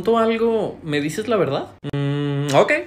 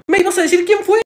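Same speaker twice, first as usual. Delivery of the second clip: very high, loud question; outraged disbelief; 13 semitones higher, 7 dB louder than usual. Acted. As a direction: explode in indignant disbelief. Acted outrage at being tricked.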